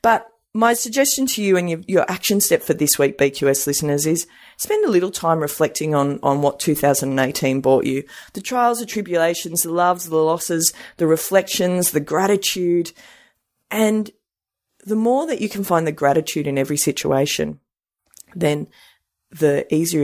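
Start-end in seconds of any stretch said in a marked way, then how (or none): none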